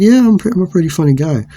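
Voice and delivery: high, loud retold retort; re-enacted high-pitched boast; cocky comeback. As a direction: fire back in mock bravado.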